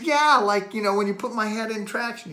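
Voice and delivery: high, loud reenacted comeback; indignant retort. Acting out indignant answer to therapist.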